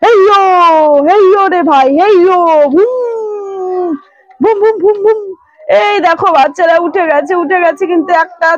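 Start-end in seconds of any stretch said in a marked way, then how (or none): 0.0-3.9 straining heave. Pretend. Hauling the truck out. 4.4-5.2 engine noise. Retold or imitated. Imitating the engine.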